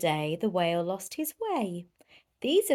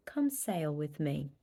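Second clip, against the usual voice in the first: softer, lower, gentle whale; gentle invitation; 5 semitones lower, 7 dB quieter than usual. Acted. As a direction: invite her tenderly.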